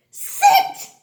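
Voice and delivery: "sit" in a very high, loud burst; triumphant blending. Shown as a demonstration. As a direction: shout out "sit" high as one word. Sounds out word, then blends.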